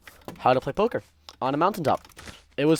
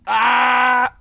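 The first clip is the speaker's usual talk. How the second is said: loud high yell of frustration; frustrated shout; 11 semitones higher, 9 dB louder than usual. Real, not acted. Gamer yelling at an annoying villager, frustrated shout. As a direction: let out a sharp, angry cry.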